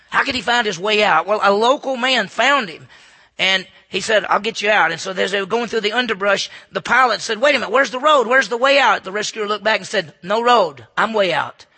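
loud storytelling sermon voice; preaching at full force. Acted. Preacher telling the jungle rescue story, loud preaching. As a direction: drive the story home to the pews.

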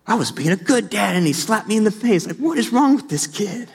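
an exasperated dad who can't believe it; laughing disbelief at the kid's slap. Retold or imitated.

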